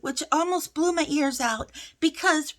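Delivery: ordinary delivery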